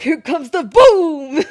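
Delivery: slow, loud build to a huge BOOM; explosive mock announcement; booming hype shout. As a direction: shout it high and stretched out. Announcer-like imitation of a blow-up.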